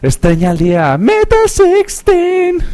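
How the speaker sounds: high, slow drawn-out title; stretched loud show name; announcer call. Acted. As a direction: belt it out like a jingle.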